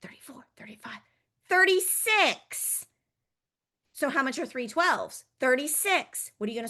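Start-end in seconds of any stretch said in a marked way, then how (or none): 0.0-1.0 unvoiced whispered counting; counting under breath. Shown as a demonstration. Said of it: "Let's whisper". 1.5-2.9 high, slow, loud final number. Shown as a demonstration. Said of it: "was our loud number".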